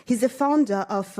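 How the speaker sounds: usual speaking voice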